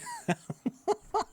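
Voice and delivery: high giggling laugh; laughing at yourself; amused laughter. Real, not acted. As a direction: crack up at your own story. Laughs at his own old email.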